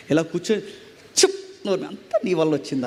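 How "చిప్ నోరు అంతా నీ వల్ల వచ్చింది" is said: hushed, low mock grumble; grieving old woman. Old woman scolding the TV villain, mock upset and hushed. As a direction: lightly imitate an upset old TV watcher. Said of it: "ఓ ముసలిది ఇలాగ కుమిలిపోతుంది".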